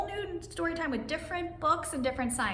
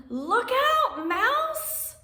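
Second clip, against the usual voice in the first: high, loud warning cry; calling out a warning; 12 semitones higher, 6 dB louder, much slower than usual. Acted. Storyteller warning Mouse running through the puddle, high loud call. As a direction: shout a warning to the careless mouse.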